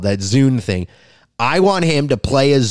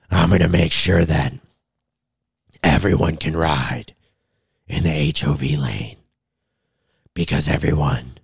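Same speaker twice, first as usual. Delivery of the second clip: Caitlyn Jenner as Batman; 7 semitones lower than usual. Retold or imitated. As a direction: growl it out like a brooding Batman. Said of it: "That's my impression of Caitlyn Jenner being Batman".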